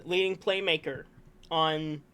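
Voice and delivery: normal talking voice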